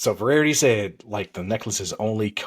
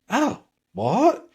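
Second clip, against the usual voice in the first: surprised cop; 4 dB louder than usual. Retold or imitated. Cop surprised in retold story.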